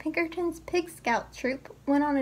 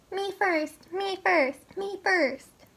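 high, sing-song "me first" teasing; mocking the pig; 6 semitones higher, slower than usual. A narrator's reading. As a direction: parrot his cry back at him. Witch mocks the pig's cry.

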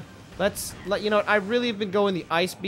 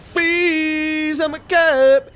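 much higher, louder "Freeze!", slow "I'm a cop"; mock cop shout; 9 semitones higher, 8 dB louder, much slower than usual. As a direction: shout like a cop, drag the end. Repeated cop bit.

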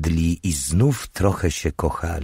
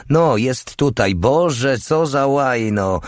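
higher-pitched exasperated outburst; 8 semitones higher, 3 dB louder, slower than usual. Acted. Alicja finds the dishcloth in the kitchen, exasperated. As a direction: grumble loudly in mild exasperation, pitched up.

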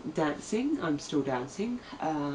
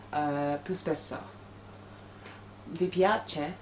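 learner's accented Italian; 3 semitones higher than usual. Real, not acted. Vlogger greeting viewers in newly learned Italian, halting learner's accent. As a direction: speak careful, halting Italian with a foreign accent. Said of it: "please excuse my terrible, um, accent and pronunciation and fluency".

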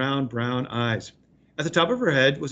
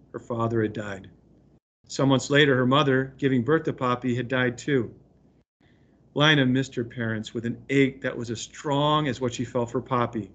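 ordinary talk

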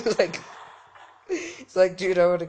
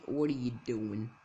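usual speaking voice

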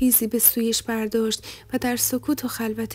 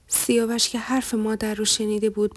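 ordinary delivery

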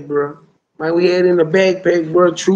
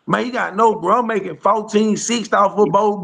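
usual speaking voice

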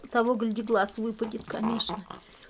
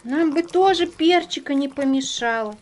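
voicing the dogs; 3 semitones higher, 9 dB louder than usual. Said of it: "кричат, хотим".

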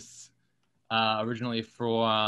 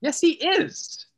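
drawn-out, high-pitched insistence; stubborn contradiction; 4 dB louder, much slower than usual. Real, not acted. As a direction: dig in and contradict slowly, pitched way up. Back-and-forth argument over Cockney.

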